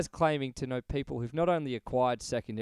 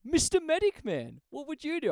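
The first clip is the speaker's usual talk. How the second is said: mocking title; 12 semitones higher than usual. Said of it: "he goes".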